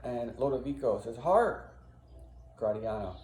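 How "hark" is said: raised, rising call for attention; alert call. Acted. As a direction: call out on hearing something. Character alerts companion to a noise.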